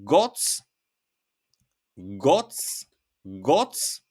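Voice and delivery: goc, set apart and said clearly; word demo. Shown as a demonstration. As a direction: model goc three times for learners. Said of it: "another two words you will hear".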